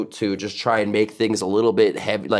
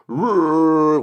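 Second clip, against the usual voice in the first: higher closed-mouth rolling growl; 6 semitones higher, 3 dB louder than usual. Shown as a demonstration. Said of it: "I close my mouth a little".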